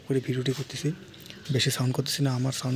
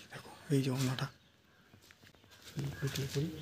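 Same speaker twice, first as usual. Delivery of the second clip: soft sneaky voice; hushed while sneaking up on the pair; quiet voice; 7 dB quieter than usual. Acted. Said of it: "আস্তে কথা বলছি।".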